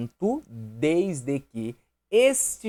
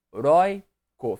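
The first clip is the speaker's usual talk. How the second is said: "Heróico" split as he, rói, co; syllable by syllable; 6 dB louder, slower than usual. Shown as a demonstration. Teacher testing for the last syllable, "Heróico" syllable by syllable. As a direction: slowly pull "Heróico" apart: he... rói... co. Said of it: "separei ideia".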